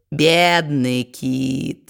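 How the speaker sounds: slow, low pitying tone; mock sympathy. A narrator's reading. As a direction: drawl it with fake pity. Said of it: "соболезнующим тоном сказал карлик". (соболезнующим тоном, медленно)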